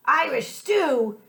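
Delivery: high, slow pirate holler. Acted. Pirate lifting the lid on stew, slow high holler. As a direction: drag out the dismay. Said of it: "he hollered".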